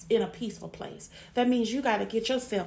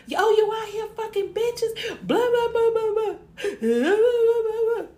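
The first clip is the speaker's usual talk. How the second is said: screamed high and loud, mocking an overreaction; mock furious yelling; 12 semitones higher, 7 dB louder than usual. Woman screaming at cheating man, hammed-up furious yell. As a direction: yell it like a jealous girlfriend losing it. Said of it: "Instead of me talking about".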